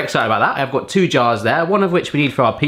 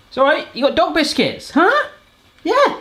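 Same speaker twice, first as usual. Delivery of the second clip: talking to the dogs; 12 semitones higher than usual. Real, not acted.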